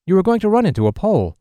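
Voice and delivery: a stranger tensely warning a blind woman; urgent, stressed cry of alarm. Retold or imitated. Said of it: "answered in a stressed tone".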